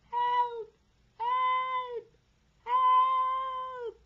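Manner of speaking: long, very high loud wail; shouting for help. A narrator's reading. Man aloft calling for help, shouting. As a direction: cry out in drawn-out desperation. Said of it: "Someone was shouting".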